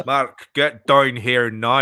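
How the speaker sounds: very slow, drawn-out Northern Irish order; attempted Northern Irish accent. Manager summoning Mark to the touchline, slow mock Northern Irish accent. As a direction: stretch each word in mock Northern Irish. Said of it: "that better?".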